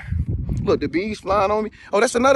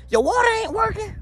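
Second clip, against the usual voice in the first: squeaky high disbelief; high incredulous voice; 13 semitones higher than usual. Acted. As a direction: shoot the pitch way up in shock. Mocking broken partner's house.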